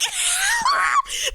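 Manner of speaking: high, shouted laugh-sob; laughing cry. Real, not acted. Teen girl laughing and crying over a confession. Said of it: "my main character moment".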